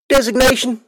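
bored guard through a speaker; irritatingly calm intercom. Acted. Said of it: "irritatingly calm".

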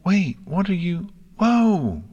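high, startled filly yelp; alarmed. Acted. Filly suddenly shoved on a swing, alarmed. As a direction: caught off guard, rising into a high cry.

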